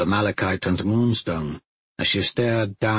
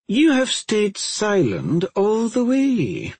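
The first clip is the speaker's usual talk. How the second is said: higher, slow, backhanded praise; mock impressed; 10 semitones higher, slower than usual. A narrator's reading. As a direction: sound impressed but mean it as an insult. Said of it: "in a voice to convey that she was impressed".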